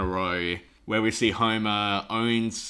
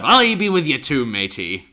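hearty mock sea-captain cry; sea captain impression; 2 semitones higher, 5 dB louder than usual. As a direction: call it out like an old sailor, dropping on "matey". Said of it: "He goes".

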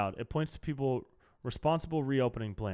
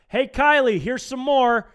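loud mocking call down the chute; mock shout; 9 semitones higher, 8 dB louder than usual. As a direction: yell it gleefully into the trash chute. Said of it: "I open the trash chute and I say".

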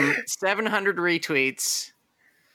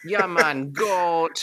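put-on light Jamaican accent; easy laid-back Jamaican accent; 2 semitones higher, much slower than usual. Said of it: "in the Jamaican accent".

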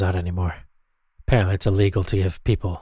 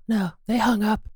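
high silly sidekick voice; 11 semitones higher than usual. Acted. Sidekick voice continues.

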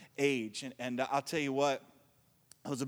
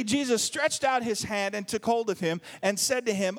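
normal talking voice

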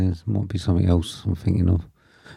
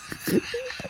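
shrieking laugh; 10 dB quieter than usual. Real, not acted. Shocked by something odd.

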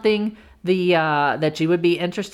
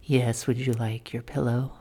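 sultry voice; 6 semitones lower than usual. Retold or imitated. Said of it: "she has that sultry voice".

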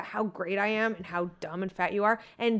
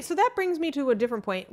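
ordinary delivery

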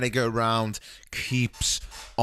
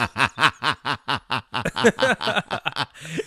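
loud laugh; 4 semitones higher, 4 dB louder than usual. Real, not acted. Big laugh at joke.

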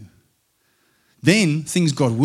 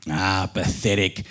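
snarled; 4 semitones lower, 4 dB quieter than usual. A narrator's reading. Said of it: "snarled the stepdad".

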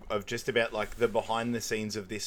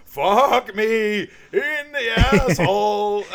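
very slow, loud, high howl; stretched comic scream; imitated agonised yell; 9 semitones higher, 10 dB louder, much slower than usual. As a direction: drag out each word in mock agony. Acting out next waxing scream.

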